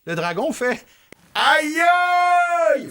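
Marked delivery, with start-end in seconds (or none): 1.4-2.9 high, loud pained cry; loud ouch. Acted. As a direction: yelp it high and loud like a hurt dragon. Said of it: "Fort comme ça".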